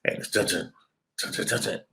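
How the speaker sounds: low soft menacing dun dun; ominous Jaws theme hummed softly; low tense hum. Shown as a demonstration. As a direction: hum the shark theme quietly.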